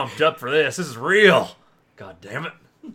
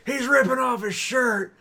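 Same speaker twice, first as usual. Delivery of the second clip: J R impression; 8 semitones higher than usual. Co-host calling the wrestling brawl for friends, frantic announcer voice. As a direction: call the brawl in mock alarm. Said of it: "Okay, J R".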